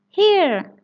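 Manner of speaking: high, loud eager cry of "Here!"; excited cry. Acted. Peppa answering the teacher's register with a high, loud cry. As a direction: call out as the register is read, eagerly. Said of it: "cries Peppa".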